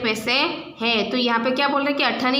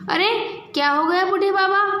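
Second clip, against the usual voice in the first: put-on higher, caring call to an old man; a student's polite, concerned question; respectful concern; 6 semitones higher than usual. Shown as a demonstration. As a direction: show how to ask an elder kindly. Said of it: "सुनो यहाँ से".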